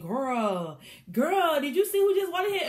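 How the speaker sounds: high, loud and fast, bursting with news; rushing up to spill the tea; excited gossip. Retold or imitated. Ivy rushing up to Hillary with gossip, high excited rush.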